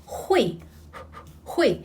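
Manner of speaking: hui with lots of air, like blowing hot soup; airy hui. Shown as a demonstration. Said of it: "there's a lot of air coming out".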